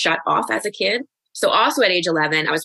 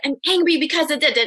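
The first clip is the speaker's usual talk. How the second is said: raised pitch, no real shouting; mock anger release; 9 semitones higher, faster than usual. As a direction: imitate a coach demonstrating an angry vent. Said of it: "start hitting that pillow and screaming".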